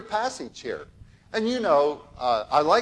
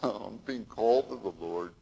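mock sad; 6 semitones lower than usual. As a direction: moan it like a reluctant recruit. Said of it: "this isn't a sad thing".

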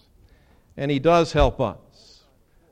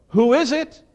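high, loud call from another room; 11 semitones higher, 8 dB louder than usual. Retold or imitated. Family member calling from inside, high loud call. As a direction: call out to whoever came in.

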